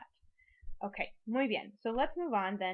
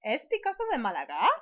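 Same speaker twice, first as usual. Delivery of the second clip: louder, quicker question rising on "Málaga"; question intonation; 5 dB louder, faster than usual. Shown as a demonstration. Teacher shows students the intonation at the end, questioning rise. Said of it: "make it sound like a question".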